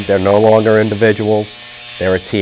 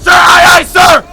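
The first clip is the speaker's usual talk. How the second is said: barked sir aye aye sir; 10 dB louder than usual. Real, not acted. Recruits yelling response.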